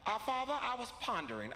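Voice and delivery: mock upper-crust son, starting high; Hassan. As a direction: announce it formally like a bougie kid. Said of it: "He say".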